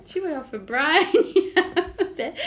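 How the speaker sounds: high-pitched words broken by laughter; laughing while talking. Real, not acted. Daughter recalling Mum's outburst, laughing as she talks. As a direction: giggle through the recollection.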